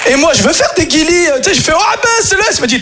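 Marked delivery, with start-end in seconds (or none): 1.7-2.4 high playful coo; mock high coo. Comedian tickling his father, high playful coo. Speaker's own tickle attempt.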